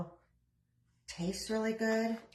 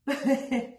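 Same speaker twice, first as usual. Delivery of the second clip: short laugh; 5 semitones higher, 4 dB louder than usual. Real, not acted. Laughs at own joke.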